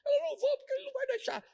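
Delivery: put-on very high falsetto teenage girl; mock cousin refusing in falsetto; whiny girl voice. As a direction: squeal it like a sulking girl. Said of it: "minha tia falou pra minha prima".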